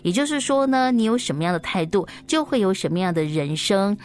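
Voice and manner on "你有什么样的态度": plain reading voice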